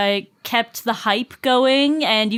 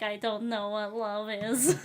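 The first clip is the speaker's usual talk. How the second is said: soft laughing quote; 2 semitones lower, 13 dB quieter, slower than usual. Retold or imitated. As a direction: murmur I don't know what love is through a laugh. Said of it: "instead of a robot voice".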